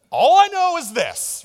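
high, loud country bumpkin voice. Acted. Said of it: "He's like a country hick".

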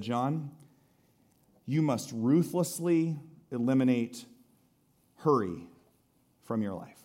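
put-on measured calm like an old sage; wise mentor. Wise old mentor speaks slowly.

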